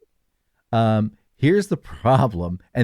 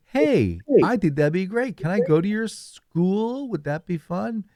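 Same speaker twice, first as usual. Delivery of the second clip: high, curious robot; eager robot voice; 8 semitones higher than usual. Pretend. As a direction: chirp it like a keen robot. Robot asks to go.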